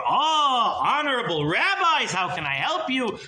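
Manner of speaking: butter up the honored guests; fawning miser. Acted.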